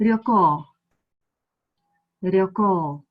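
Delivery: ryoko with the final o held long; prolonged o. Shown as a demonstration. Teacher modelling pronunciation, ryoko with a long final o. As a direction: draw out the last o of ryoko. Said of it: "o prolonged".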